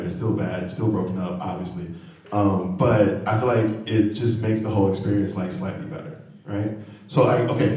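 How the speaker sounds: ordinary delivery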